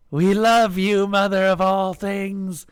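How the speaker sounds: loud, high, drawn-out cry; fervent high shout; crowd screaming. A narrator's reading. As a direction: scream devotion as one crowd. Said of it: "the crowd screamed".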